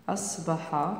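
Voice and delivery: "أصبح" softly, as a reminder while writing; soft dictation. Shown as a demonstration. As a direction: quietly repeat "أصبح" for the class. Teacher repeats word softly for writing.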